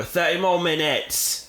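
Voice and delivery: put-on British accent, drawn out. Podcast host setting a timer, hammed-up British accent. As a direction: playfully exaggerate a British accent. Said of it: "I feel weird doing my British accent".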